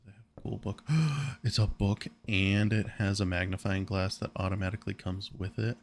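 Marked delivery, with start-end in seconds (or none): 0.9-1.4 quick delighted gasp; excited gasp. Real, not acted. Finds a cool book.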